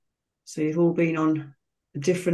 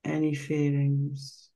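slow, calm meditation voice with long pauses between phrases; guided meditation; slower than usual. Real, not acted. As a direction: guide listeners gently into relaxation, phrase by unhurried phrase.

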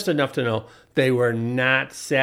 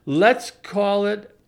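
normal talking voice

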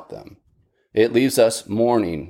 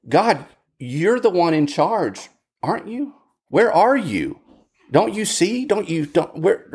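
higher-pitched, urgent pleading; pleading protest to God; 7 semitones higher than usual. Retold or imitated. David protesting to God, high and pleading. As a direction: demand an answer, pitch climbing on questions. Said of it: "filled with lament and protest to God".

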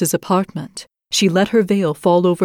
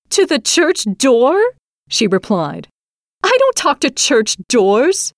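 haughty dismissal; 7 semitones higher than usual. A narrator's reading. Bride dodges prince's question.

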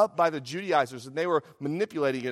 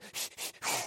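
short breathy puffs; imitated labor panting; 11 dB quieter than usual. Preacher acting out seventeen hours of labor, mock panting. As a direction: puff like a mother in labor.

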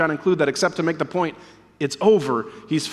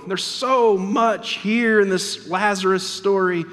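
usual speaking voice

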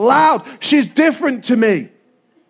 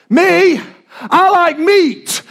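hammed-up boast; 7 semitones higher, 4 dB louder, much slower than usual. Boasting about meat.

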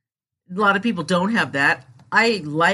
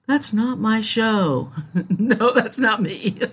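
laughing; 5 semitones higher, slower than usual. Real, not acted.

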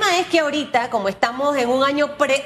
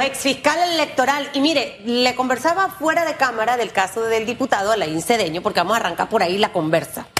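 normal talking voice

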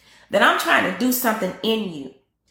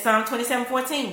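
ordinary talk

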